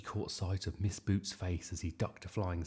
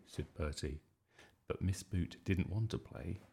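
usual speaking voice

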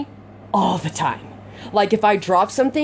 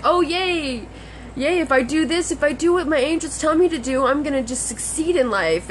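sarcastic mock cheer; 6 semitones higher than usual. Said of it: "It's like".

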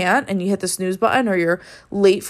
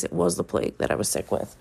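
whispered aside; 7 dB quieter than usual. Real, not acted. Joking aside about being sick.

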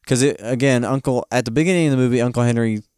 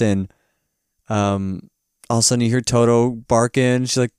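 normal talking voice

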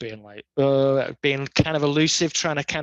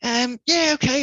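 much higher mock-breezy voice; acting all fresh; 11 semitones higher than usual. Skaters coming out acting fresh, mock breezy high voice. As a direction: mimic someone pretending all is fine. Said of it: "acting all fresh".

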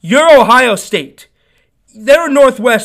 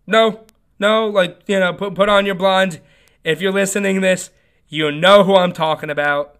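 ordinary talk, nothing marked

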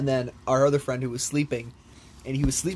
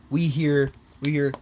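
ordinary talk, nothing marked

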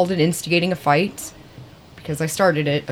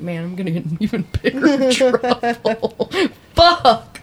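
laughing panic; 6 semitones higher, slower than usual. Retold or imitated. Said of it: "And I was like".

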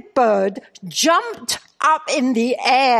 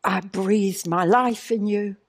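softer, tender, reverent voice of God; Holy Spirit's gentle voice; 4 semitones lower, 5 dB quieter than usual. Retold or imitated. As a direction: speak softly like a loving divine whisper. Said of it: "the Holy Spirit said to me".

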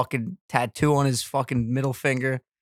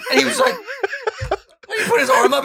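ordinary talk

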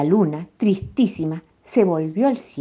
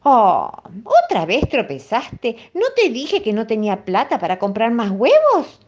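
higher, louder scolding; angry mother; 6 semitones higher, 4 dB louder than usual. A narrator's reading. Angry mother scolds Felipito over broken eggs. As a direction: scold the clumsy boy. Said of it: "muy enojada".

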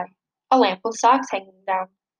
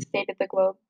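plain reading voice

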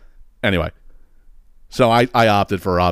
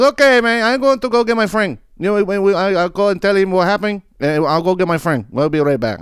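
higher, lightly voiced helpful stranger; imitating the neighbor; 8 semitones higher than usual. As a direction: reassure him you'll bring a friend. Neighbor promises to fetch friend.

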